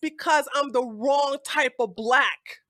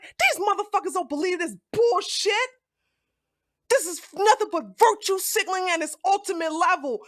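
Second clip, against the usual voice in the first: retold anger; 6 semitones higher, faster than usual. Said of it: "I started getting angry".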